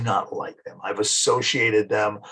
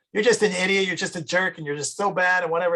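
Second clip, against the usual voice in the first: mock yelling girlfriend; 7 semitones higher than usual. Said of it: "a girl who's always yelling".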